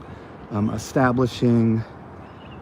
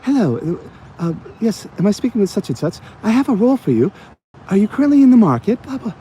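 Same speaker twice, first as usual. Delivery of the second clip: much higher, fast, prim British phone voice; mock the polite British recruiter, pitched way up; posh British recruiter; 10 semitones higher, faster than usual. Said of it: "And they're like".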